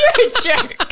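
high, loud and laughing; playful insult through a burst of laughter; laughing retort. Real, not acted. Teased about an old hairstyle.

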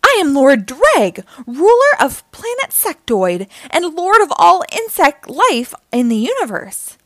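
grand, loud, high proclamation; boastful villain. A narrator's reading. Lord Dreg introduces himself, loud bragging. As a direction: announce yourself grandly, easing at the end. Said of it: "he bragged".

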